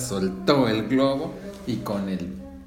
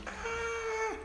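scream; 7 dB quieter than usual. Acted. Girl flying out of car.

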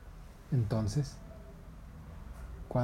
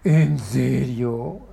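very slow, much louder disbelief; shocked; 2 semitones higher, 12 dB louder, much slower than usual. Acted. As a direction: drag out the shock. Monkey shocked.

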